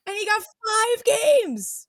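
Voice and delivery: high and drawn-out; slow high reveal. Acted. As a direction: stretch it out in a high voice. Dramatic reveal of the punishment.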